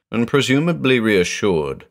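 usual speaking voice

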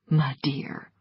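soft, low murmur; quiet. A narrator's reading. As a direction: say it quietly and intimately. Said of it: "he said quietly".

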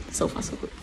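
soft whispered aside; whispering. Real, not acted. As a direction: breathe it out in a whisper. Same words repeated, whispered.

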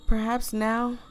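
usual speaking voice

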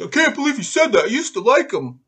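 high, whiny mock complaint; mock bitching. Host mimicking people on Twitter, mock bitching. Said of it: "are on Twitter just bitching".

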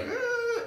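high-pitched frustrated squeal; mock overwhelmed child's whine; hot-brain noise. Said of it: "where their brain is just like".

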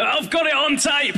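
catching the businessman out, triumphant shout. Retold or imitated. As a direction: shout it like a gotcha.

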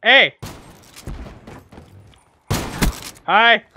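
loud shouted hey and hi; real taunting shout; loud greeting. Real, not acted. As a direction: call out at the enemy. Greeting enemies mockingly.

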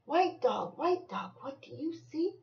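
high, lighter singsong refrain; storybook call; reading to a child. Acted. Reading picture book aloud to child.

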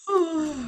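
sharp high despairing "ah"; anguished cry. Real, not acted. Gamer stuck in the desert biomes, high anguished cry. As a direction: let out a pained little cry.

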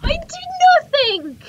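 very high, loud wail of innocence; outraged protest. Real, not acted. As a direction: wail that it's unfair. Protests being killed.

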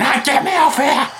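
high squealing mock cry for help; mock scream. Retelling the scene, the cameraman squeals in mock panic. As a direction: yelp it like the terrified guy clinging on. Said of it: "he's screaming".

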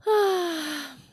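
put-on a big, loud huffing sigh from a sulky girl; loud childish sigh. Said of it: "Adelaide sighed loudly".

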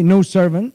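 "no servant" said as one piece, no break; joined together. Shown as a demonstration. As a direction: run "no servant" together without stopping. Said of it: "one word or one piece".

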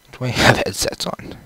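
ordinary talk, nothing marked

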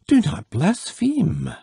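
high-pitched outcry; crying out. A narrator's reading. Son to his blaspheming father, crying out. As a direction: cry out in alarmed rebuke, pitched high. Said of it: "cried the young man".

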